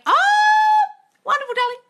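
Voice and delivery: high-pitched congratulation; gushing delight. Real, not acted. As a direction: squeal with joy for them. Gushing at a viewer's good news.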